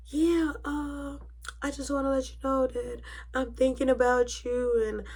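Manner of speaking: hushed flirty impression of mom; mom's flirty big talk. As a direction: imitate mom sweet-talking someone on the phone. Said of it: "so she's talking, like, this big talk".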